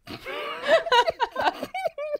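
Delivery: high burst of laughter. Real, not acted. Laughing at a winner's name.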